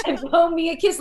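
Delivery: high, loud hammed-up quote; playful imitation. Host quoting a comedian's absurd example, playfully imitating. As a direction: playfully mimic an absurd request.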